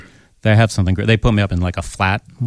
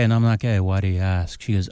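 ordinary talk

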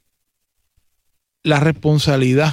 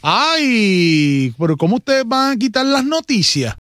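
high-pitched protest at a removal; drawn-out exclamation of disbelief; indignant dismay; 6 semitones higher, slower than usual. Real, not acted. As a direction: wail "Ay", then protest high and incredulous. Finds the news link was removed.

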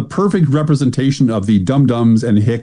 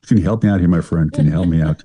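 lower, tough-guy Bogart voice; Bogart impression; 7 semitones lower than usual. Host imitating Bogart to ask the AI agent for help, low and tough. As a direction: lean in like Bogart asking a pal a favor. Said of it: "if you could do Bogart".

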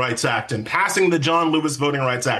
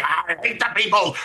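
mock rabid, drooling rant; slobbering caricature of a raving zealot; rabid Stephen Miller. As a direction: do a hammed-up frothing hater in a put-on voice. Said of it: "just rabid, just, you know, drooling".

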